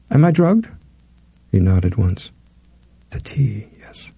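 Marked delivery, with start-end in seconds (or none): 0.1-0.7 high startled question; alarmed. A narrator's reading. 3.1-4.1 soft murmured confirmation. A narrator's reading. He confirms softly.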